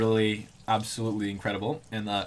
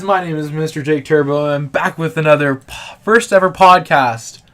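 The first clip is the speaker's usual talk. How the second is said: podcast host intro; 5 semitones higher, 13 dB louder than usual. Acted. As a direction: announce the show like a host.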